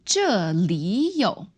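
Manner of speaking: slow 这里有, 里 rising as second tone; tone change demo. Shown as a demonstration. Teacher repeats "here's something" for learners, slow tone change demo. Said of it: "but when we have 这里有, it becomes".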